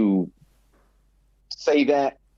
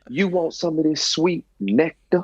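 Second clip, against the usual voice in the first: high, teasing come-on voice; hammed-up seductive woman; flirty hustler; 8 semitones higher than usual. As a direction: pitch it up and tease for the wallet. Acted flirt continues.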